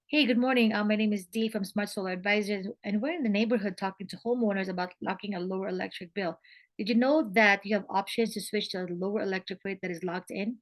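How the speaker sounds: normal talking voice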